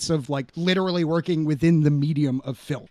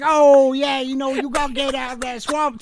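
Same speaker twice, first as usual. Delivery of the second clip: imitating Appalachian accent; 7 semitones higher than usual. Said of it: "deep Appalachia".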